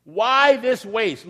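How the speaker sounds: slow, scornful why this waste; indignant disciples. Acted. Disciples scolding the woman, slow indignant scorn. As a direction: drag it out in indignant disbelief. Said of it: "The disciples got indignant and they said".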